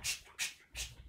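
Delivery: soft, voiceless hushing; mother shushing. Acted. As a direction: shush a pestering child. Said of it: "Et maman, elle fait".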